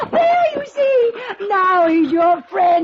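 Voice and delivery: loud, high, laughing cry of delight. Acted. Lady delighted as her dog takes the biscuit, loud laughing.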